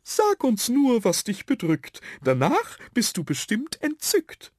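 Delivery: high sprite voice; high-pitched storybook creature; little yellow dot coaxing. A narrator's reading. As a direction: coax in a tiny high put-on voice. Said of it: "meinte der Gelbe".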